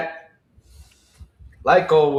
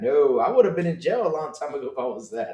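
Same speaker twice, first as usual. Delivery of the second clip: ordinary delivery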